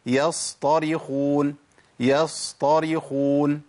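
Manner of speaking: يصطرخون recited slowly, the ص held on a level pitch; timed sad. Shown as a demonstration. Teacher showing students the length of sad, slow measured recitation. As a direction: hold the ص in يصطرخون for its full time. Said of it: "شفت الصاد عندي خد زمن قد إيه".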